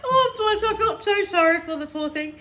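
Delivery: high, laughing "oh bless" fading into pity; laughing sympathy. Real, not acted. Telling a friend about the poor hairdresser, laughing. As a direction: laugh helplessly, then feel sorry.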